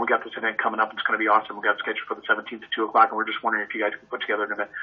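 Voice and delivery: rattling it off quickly, excited; eager fast talker. Retold or imitated. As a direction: rush through it like an eager client. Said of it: "you got an I or a D".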